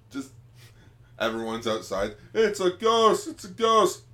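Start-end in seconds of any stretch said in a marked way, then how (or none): 2.3-4.0 much higher, louder alarmed shouting; panicked yell. Acted. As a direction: cry out in mock panic. Crowd panicking acted.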